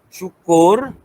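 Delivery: drawn-out, lightly voiced motivator's cue; motivational speaker. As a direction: gaya pakar motivasi yang ringan. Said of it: "macam ahli motivasi katalah". (gaya ahli motivasi)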